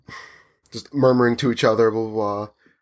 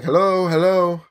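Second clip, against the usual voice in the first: calling out; 6 semitones higher than usual. Retold or imitated. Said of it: "some guy saying".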